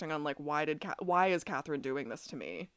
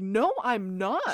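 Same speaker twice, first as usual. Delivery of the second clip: slow drawn-out higher protest; mock denial; 5 semitones higher, 6 dB louder, much slower than usual. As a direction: stretch the denial out slowly. Caroline voiced denying.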